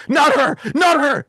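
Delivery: high, slow mock shout of panic; imitating a panicked demon; freaking out. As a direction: cry out in acted dread, drawing the words out. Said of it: "the guy starts freaking out from her".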